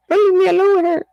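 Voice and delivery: high whiny plea; lightly hammed-up frightened man; mock scared plea. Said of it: "always calling my mom".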